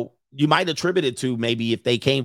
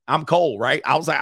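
ordinary delivery

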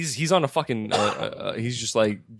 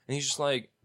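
ordinary talk

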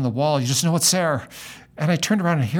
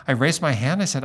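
ordinary talk, nothing marked

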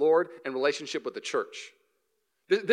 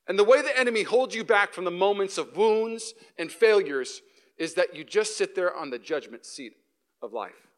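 ordinary talk, nothing marked

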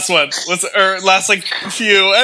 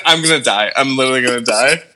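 ordinary delivery

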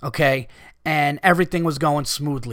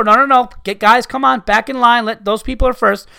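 raised, higher parental voice; parent calling kids back; 4 semitones higher, 4 dB louder than usual. Acted. Dad to his kids in line, firm and raised. Acting out correcting own kids.

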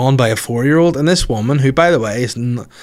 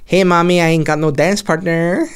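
higher, louder hammed-up Brazilian accent; mock Brazilian accent; 7 semitones higher, 3 dB louder than usual. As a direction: whine to mommy in a jokey Brazilian accent. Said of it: "this is my Brazilian accent".

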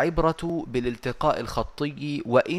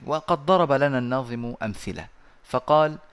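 normal talking voice

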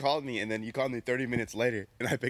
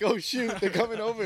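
high-pitched, louder retold alarm; mock panic; 10 semitones higher, 4 dB louder than usual. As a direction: act out a sudden mild panic. Retelling panic at friends arriving.

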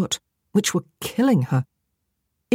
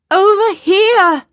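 high, loud, drawn-out holler; calling out; 8 dB louder, slower than usual. A narrator's reading. Woman on a roof calling a distant man over, hollering. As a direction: hail someone from afar, stretching the words. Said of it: "she called out".

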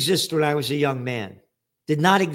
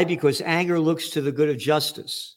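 ordinary talk

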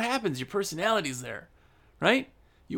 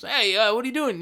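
brisk, higher-pitched New York greeting; New York accent; 5 semitones higher than usual. Acted. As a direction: imitate a fast-talking New Yorker. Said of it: "somebody from New York".

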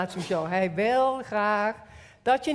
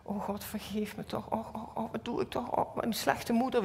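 soft, half-whispered lament; zacht, wanhopig smekend; soft desperate plea; 6 dB quieter than usual. Retold or imitated. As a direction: whimper it out in shame. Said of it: "met God zo dat leert".

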